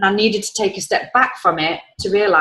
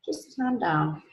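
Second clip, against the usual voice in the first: much softer, hushed "Just calm down" to herself; calming self-talk; 12 dB quieter, slower than usual. Retold or imitated. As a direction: soothe yourself under your breath. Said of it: "And then I was like".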